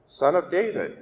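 crying out. A narrator's reading. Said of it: "cried out even more loudly".

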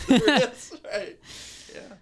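laughing through the words. Real, not acted. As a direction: say it while laughing. Laughing while repeating catchphrase.

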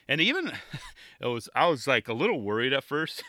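amused, talking through a laugh. Real, not acted. Podcaster cracking up at their own notes, laughing. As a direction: let a laugh break into the words. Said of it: "sorry, I'm laughing".

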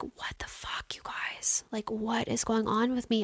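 hushed, breathy disbelief under her breath; whispered panic. Retold or imitated. Her panicked thoughts in the grocery aisle, whispered.

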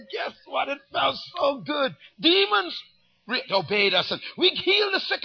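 loud, eager retelling of disciples; excited disciples. Retold or imitated. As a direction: burst out like thrilled disciples.

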